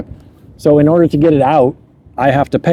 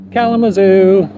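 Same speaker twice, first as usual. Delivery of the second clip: Kalamazoo repeated higher with a comic lift; Kalamazoo, higher and amused; 5 semitones higher than usual. Real, not acted. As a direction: savour the funny name Kalamazoo. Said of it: "repeated with amusement".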